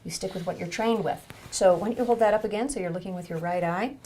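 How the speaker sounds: usual speaking voice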